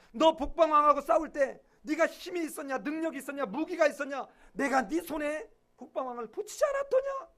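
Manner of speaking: preacher voicing God's indignant rebuke to Abraham; God rebuking Abraham. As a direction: scold him loudly, like a wounded parent. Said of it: "하나님이 무슨 생각이 들었을까".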